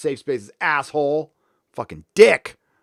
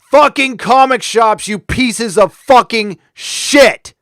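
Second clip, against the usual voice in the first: higher, louder raging yell at the writers; furious shout; 7 semitones higher, 8 dB louder, slower than usual. Real, not acted. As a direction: bellow it out, fed up and furious. Furious rant at comic writers.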